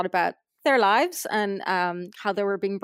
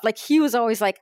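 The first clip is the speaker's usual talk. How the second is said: ordinary delivery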